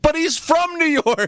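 high-pitched incredulous protest, hammed up; retold protest. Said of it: "I'm like".

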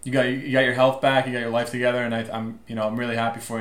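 normal talking voice

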